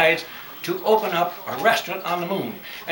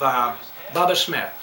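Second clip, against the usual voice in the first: usual speaking voice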